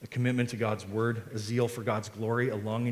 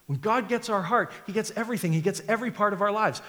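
When God gets our heart pitched high, he gets everything dropping; preacher driving the point home; higher emphatic refrain; 8 semitones higher, faster than usual. Shown as a demonstration. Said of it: "repeated refrain".

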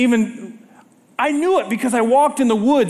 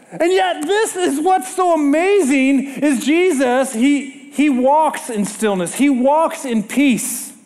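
usual speaking voice

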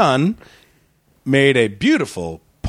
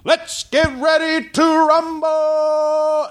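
high, drawn-out fight announcer call; full-on arena announcer's catchphrase; ring announcer; much slower than usual. Acted. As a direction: hold the last word long like a boxing MC. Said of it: "And he goes".

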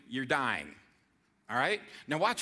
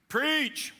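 high, punchy, fired up PREACH; 8 semitones higher, 3 dB louder than usual. Shown as a demonstration. Pastor showing the congregation the fired-up response, high and punchy. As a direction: call it out like a fired-up church member. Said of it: "This folks get a little more fired up".

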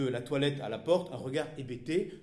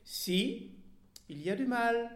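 higher, still suspicious; wary retort; 9 semitones higher than usual. A narrator's reading. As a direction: push back, not yet convinced. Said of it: "encore méfiante, répliqua".

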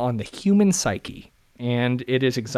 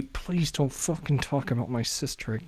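muttered warning; 8 dB quieter than usual. Real, not acted. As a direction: mutter it under your breath. Muttered aside again.

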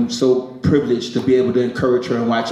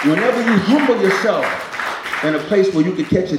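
preaching; 6 semitones higher, faster than usual. Real, not acted. Preaching to congregation.

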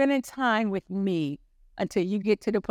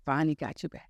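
lightly voiced God, lower; 6 semitones lower, 4 dB quieter, faster than usual. The Lord answering the retired preacher, lightly voiced and low. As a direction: drop it slightly for God's reply. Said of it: "but he said, Now".

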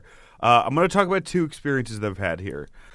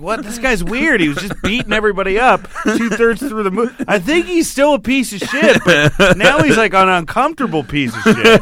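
high, loud mock bewilderment; pitched-up comic incredulity; retold baffled reaction; 10 semitones higher, 7 dB louder than usual. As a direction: voice a weirded-out crowd, laughing by the end. Voices everyone's baffled reaction.

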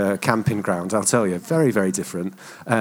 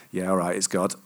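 lower, mildly grudging concession; reluctant agreement; 4 semitones lower, 4 dB quieter than usual. Retold or imitated. Husband eventually agreeing with his wife about God, reluctant. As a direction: give in reluctantly, a little low.

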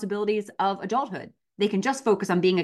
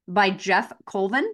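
ordinary talk

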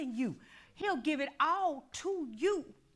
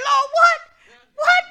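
startled reply; 18 dB louder than usual. Retold or imitated.

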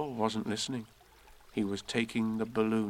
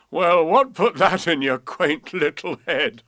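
loud, hearty amusement; laughing; 6 semitones higher, 9 dB louder than usual. A narrator's reading. Coachman amused by a little girl's question, laughing. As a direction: boom it out, breaking into a laugh. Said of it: "laughed and said".

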